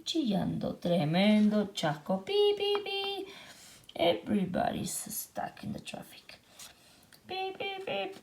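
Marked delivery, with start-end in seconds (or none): 2.2-3.3 high beep beep; a much higher honking sound; horn beeps. Acted. Traffic jam horns. 7.3-8.1 high beep beep; a much higher honking sound; horn beeps. Acted. Traffic jam horns.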